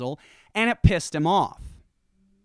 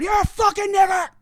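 high, loud shouted insult; demonstrating a loud, high-pitched outburst; public outburst; 12 semitones higher, 5 dB louder than usual. Shown as a demonstration. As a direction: yell it out for everyone to hear.

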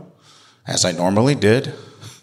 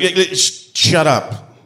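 higher, stammering gruff dad voice; gruff dad cutting in; 8 semitones higher, slower than usual. Retold or imitated. Father snaps at the boy on his porch, sputtering.

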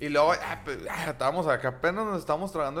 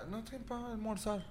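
señora pidiendo; 6 semitones higher, 14 dB quieter, slower than usual. Retold or imitated. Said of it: "pedía".

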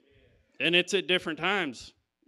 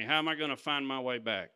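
ordinary delivery

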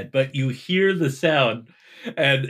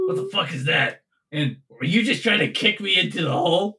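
Branson; 5 semitones higher, faster than usual. Acted. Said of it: "And Branson goes".

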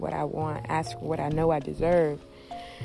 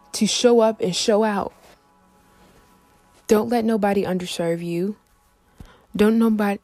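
louder, rising preacher-like push; fired-up pep talk; 7 dB louder than usual. Real, not acted. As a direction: hype them up, getting louder.

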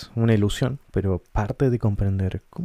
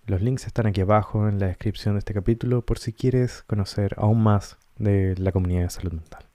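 ordinary talk

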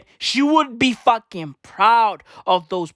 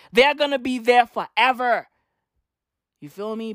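ordinary talk, nothing marked

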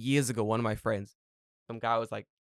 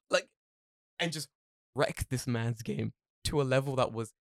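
ordinary talk, nothing marked

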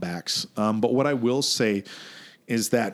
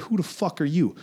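retold challenge; 7 semitones higher than usual. Acts out challenging the man.

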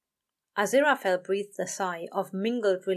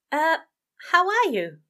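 high, louder flustered question; flustered stammer; 9 semitones higher, 7 dB louder than usual. A narrator's reading. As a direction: still rattled, fumbling for words. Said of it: "he stammered".

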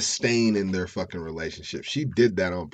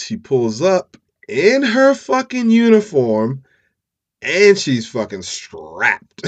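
loud, slow build with "strapped" drawn out; dramatic emphatic reveal; 6 semitones higher, 10 dB louder, slower than usual. Real, not acted. Storyteller revealing she arrived armed in uniform, emphatic relish. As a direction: hit "uniform" high and loud, then stretch "strapped".

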